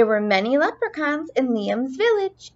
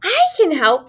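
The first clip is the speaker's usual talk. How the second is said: louder, higher eager call; eager shout; 5 semitones higher, 5 dB louder than usual. Acted. Little Liam calling to the leprechauns, eager shout. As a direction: shout out the offer to help. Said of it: "Liam shouted".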